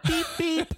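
high laughing beep beep; car horn imitation. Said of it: "like the horns in my car".

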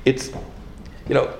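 loud, driving sermon cadence; preaching at full force. Acted. Rabbi preaching to his congregation, loud and driving.

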